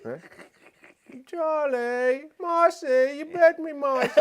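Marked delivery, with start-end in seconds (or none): 1.3-4.2 put-on loud, high British child wail; British kid. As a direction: cry out slowly as a bitten British boy. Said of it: "I think it's a British kid though".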